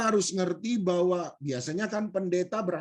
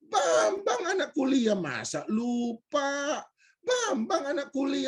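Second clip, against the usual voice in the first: high, stretched, whiny insistence; mock wounded disbelief; 9 semitones higher, slower than usual. Stranger to the man who forgot him, mock wounded. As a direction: imitate a pleading wail of disbelief.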